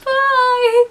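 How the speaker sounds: high, loud, stretched-out farewell; excited goodbye. Retold or imitated. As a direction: call out an over-keen goodbye. Retold excited goodbye to examiner.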